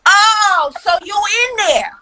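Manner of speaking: playful accusing mom; mock indignant. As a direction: call out a kid who's been fooling you. Mock confronting son who fooled her.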